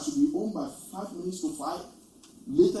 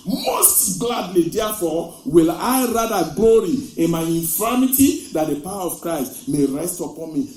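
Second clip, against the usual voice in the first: loud, proclaiming Bible verse; scripture reading; 10 dB louder than usual. Real, not acted. Preacher reading Corinthians to the church, loud and proclaiming. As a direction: read it out like a proclamation.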